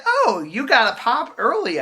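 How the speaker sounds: high mock-perky voice; mimicked annoying girl. Girl commenting on his early drink, high mimicry. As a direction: imitate her, pitched well up.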